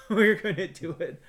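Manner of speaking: laughing. Retold or imitated. Board member agreeing to the gig, laughing. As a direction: laugh the yes out.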